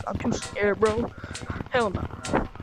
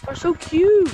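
excited squeal; 8 semitones higher, 4 dB louder than usual. Real, not acted. Gasping at tiny monkey avatars.

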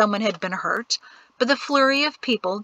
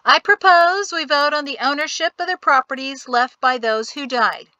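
announcing; 6 semitones higher, 4 dB louder than usual. Acted. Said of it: "he announced".

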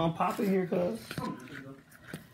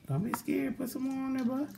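higher, softer coaxing voice; 11 semitones higher, 6 dB quieter than usual. Real, not acted. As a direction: coax the little one along, pitched up and gentle. Coaxing little cousin to season.

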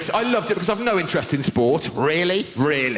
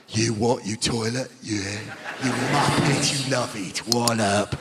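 low, rough Cockney cabbie voice; a lightly done Cockney geezer heckling; Cockney cabbie mocking his fare; Cockney taxi driver; 8 semitones lower than usual. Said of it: "Proper Cockney".

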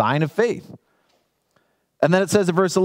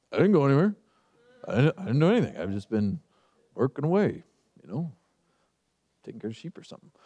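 lightly voiced guilty Gehazi; 4 semitones lower, 3 dB quieter, faster than usual. Gehazi lying to Elisha.

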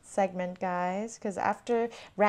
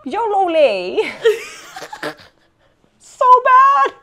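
giggling through the words; laughing; 12 semitones higher, 9 dB louder than usual. Real, not acted. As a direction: laugh it out, high and loud. Laughing at own Mandarin.

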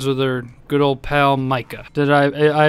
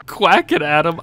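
ordinary talk

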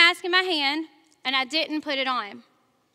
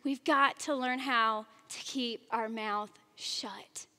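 slow and much softer; hushed; 5 semitones lower, 10 dB quieter, slower than usual. Shown as a demonstration. Preacher urging the congregation to hush, slow and soft. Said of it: "preacher drops voice for emphasis".

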